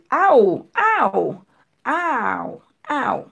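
aou high and stretched, gliding from a to u; diphthong demonstration. Shown as a demonstration. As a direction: call out aou repeatedly for learners. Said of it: "Ou di aou". (aou byen long, vwa wo)

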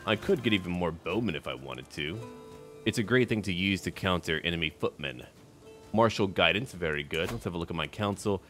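normal talking voice

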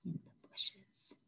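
soft secret whisper. A narrator's reading. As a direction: tell it like a secret. Tiger hiding secretly.

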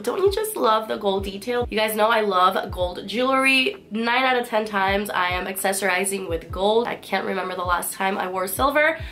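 ordinary talk, nothing marked

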